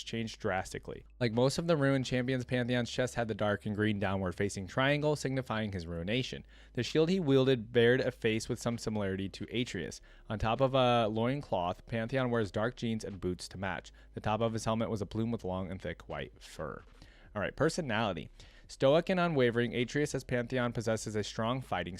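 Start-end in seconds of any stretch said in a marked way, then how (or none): none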